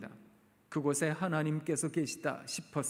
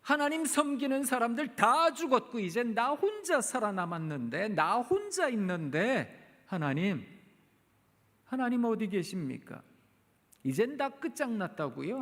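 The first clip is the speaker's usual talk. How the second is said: pleading lament; 5 semitones higher than usual. Acted. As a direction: pour out the complaint to God. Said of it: "하소연하고 싶었던 말을 쏟아냈던". (하나님께 절박하게 하소연하는 목소리)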